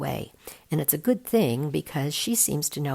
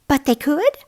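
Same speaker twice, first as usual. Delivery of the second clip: put-on much higher, louder worried kid; young boy voice; 11 semitones higher, 9 dB louder than usual. A narrator's reading. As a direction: push back anxiously. Kid presses Spider-Woman.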